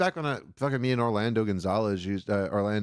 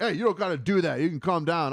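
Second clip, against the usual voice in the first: high-pitched retold objection; raised-pitch mock remonstrance; acted quarrel; 7 semitones higher than usual. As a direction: imitate someone protesting in an argument. Acting out son's side of quarrel.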